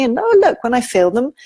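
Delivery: acted little marvel at what they notice; acted discovery. Voicing a child discovering feelings pass.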